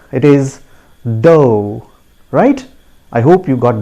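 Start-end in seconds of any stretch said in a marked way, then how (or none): 1.0-1.9 "though" rhyming with go; correct pronunciation. Shown as a demonstration. Teacher telling learners the correct form, "though" rhyming with go. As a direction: say "though" as DOH, long o. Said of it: "listen to me carefully".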